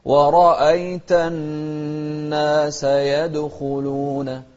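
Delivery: slow melodic tajweed chant; Quran recitation. Shown as a demonstration. Teacher reciting Quran to students, slow melodic chant.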